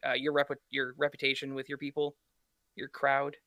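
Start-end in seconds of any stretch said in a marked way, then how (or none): none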